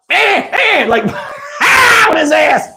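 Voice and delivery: high, laughing shout. Real, not acted. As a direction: crack up as he goes down. Laughing at him falling.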